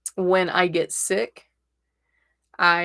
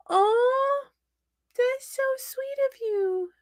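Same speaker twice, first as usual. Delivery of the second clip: touched; slower than usual. Real, not acted. Touched by the gift.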